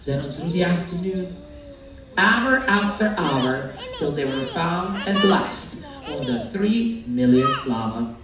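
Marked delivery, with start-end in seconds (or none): none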